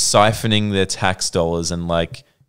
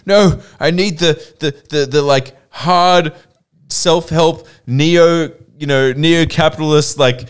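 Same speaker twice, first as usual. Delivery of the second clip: higher, louder mock self-convincing rant; hammed-up zeal, pitched up and stumbling; midlife-crisis rant; 8 semitones higher, 5 dB louder than usual. Imagining himself at forty craving free market, mock fired-up rant.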